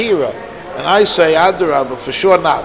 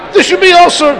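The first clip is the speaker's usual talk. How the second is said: sharply higher, announcing the vow; a raised, formal vow pronouncement; mock vow declaration; 10 semitones higher than usual. Shown as a demonstration. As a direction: declare it like a formula, pitched up.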